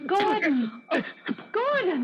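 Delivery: high frantic calls with a laugh-like gasp; panicked cry. Acted. Woman crying out as the hand seizes Gordon, panicked. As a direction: call his name in alarm, pitch soaring.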